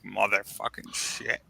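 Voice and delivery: low, slow cursing to himself; muted curse. Retold or imitated. Friend retells his dismay at the drunk guests, muted curse. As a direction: swear softly and slowly, drawn out.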